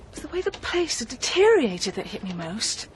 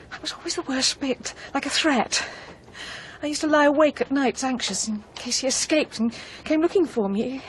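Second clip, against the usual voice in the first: ordinary talk, nothing marked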